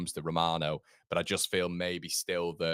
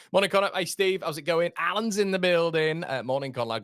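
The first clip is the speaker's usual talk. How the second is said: high, loud cheery shout-outs to chat; excited greeting; 11 semitones higher, 6 dB louder than usual. Real, not acted. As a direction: bounce up and greet each viewer. Greeting viewers in live chat.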